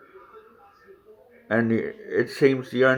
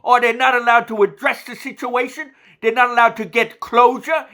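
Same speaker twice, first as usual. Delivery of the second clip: higher, insistent spirit voice speaking through the medium; a lightly raised voice from beyond, pressing its point; channeled entity; 11 semitones higher than usual. Acted.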